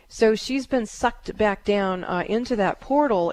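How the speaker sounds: normal talking voice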